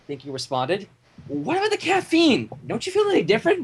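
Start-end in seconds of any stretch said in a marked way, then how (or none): 1.3-3.6 worried Mrs. Cake. Acted.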